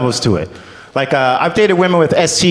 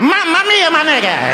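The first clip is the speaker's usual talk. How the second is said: very high, over-the-top Italian exclamation; mock Italian accent. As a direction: peak in a cartoon Italian accent. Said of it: "not sure where the Italian comes from".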